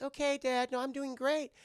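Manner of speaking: high-pitched imitation of a daughter; daughter reassuring dad. Daughter brushes off worried dad.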